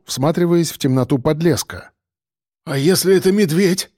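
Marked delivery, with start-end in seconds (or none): 2.6-3.9 higher, timid bleating question. A narrator's reading. A man in the crowd fears a bear, timid bleat. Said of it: "проблеял кто-то из толпы, и голос его дрогнул".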